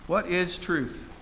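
drawn out, very slowly, letting it sink in; slow, pondering. Acted. Preacher repeats the question to the congregation, slowly pondering. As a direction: weigh each word, slow and reflective.

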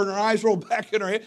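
ordinary talk, nothing marked

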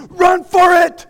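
panicked shout. Retold or imitated. Preacher voicing panicking man on the ship, shouting. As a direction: bellow like a man abandoning ship. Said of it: "Man saying".

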